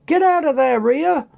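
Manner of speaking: urgent high-pitched warning call. A narrator's reading. Dylan warns Rhea as the big clone charges, urgent call. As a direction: call a warning across a brawl. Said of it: "called out".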